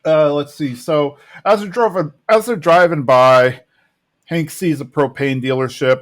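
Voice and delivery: ordinary talk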